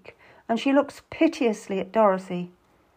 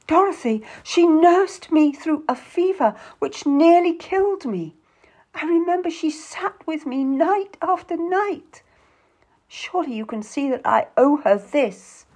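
high, dramatic pleading; impassioned appeal; 9 semitones higher, 4 dB louder than usual. A narrator's reading. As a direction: implore your sister with feeling. Sister argues emotionally for her visit.

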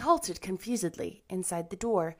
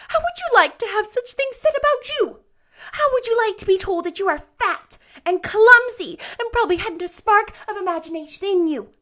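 put-on high, loud child's tirade; furious, tearful indignation, very high; vehement; 12 semitones higher, 11 dB louder than usual. A narrator's reading. Anne tells Rachel how much it hurt, vehement. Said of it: "she repeated vehemently".

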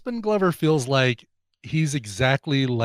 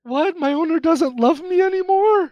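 much higher, slow, bewildered puppy voice; dog voice; slower than usual. Pretend. A friend voices an abandoned dog, high and hurt. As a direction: play a hurt dog wondering why it is sent away.

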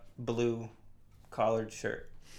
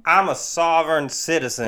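loud, raised-pitch mock heartland holler; yelling hodgepodge Southern-style accent; 5 semitones higher, 6 dB louder than usual. Mock sovereign citizen breaking into the vaccine talk, yelling in a hodgepodge accent. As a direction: yell it in a put-on middle-of-the-country accent. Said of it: "Why are you yelling?".

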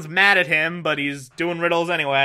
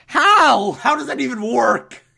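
baffled; 7 semitones higher, 5 dB louder than usual. Real, not acted. Baffled by the movie.

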